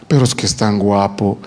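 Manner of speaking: a hint of a dreamy young woman; mock smitten woman. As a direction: mimic her swooning excuse, low and mocking. Mocking the smitten woman again.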